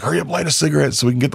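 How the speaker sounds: high, fast panicked order; panicked rush. Retold or imitated. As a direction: rush his friends before the cop arrives. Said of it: "And he's like".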